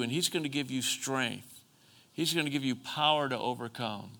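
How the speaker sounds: soft, comforting preacher's voice; tender reassurance. Real, not acted. Preacher promising the hurting that they will be held, tenderly. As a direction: console them gently, promise by promise. Comforting those who cry.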